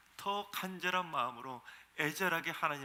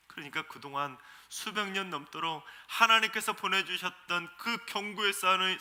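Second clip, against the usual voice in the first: normal talking voice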